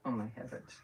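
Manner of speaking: ordinary delivery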